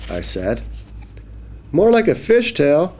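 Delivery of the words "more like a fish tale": high playful fish quip; joking fish. Acted. Fish joking with the boy, high and playful. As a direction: crack a joke in a small fish voice. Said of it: "said the fish".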